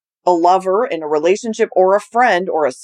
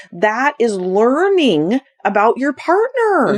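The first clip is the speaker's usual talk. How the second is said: ordinary delivery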